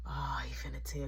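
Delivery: panicked whisper. Retold or imitated. Brother fearing a snitch, a panicked whisper. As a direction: whisper it so grandma can't hear. Panicking aside under breath.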